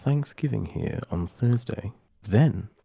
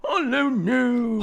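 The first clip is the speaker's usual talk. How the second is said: drawn-out high greeting; 12 semitones higher, much slower than usual. Real, not acted.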